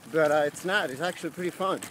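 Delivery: shivering cold voice; cold, trembling a little while cycling. Real, not acted. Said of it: "by my voice tone, you can tell that I'm pretty cold".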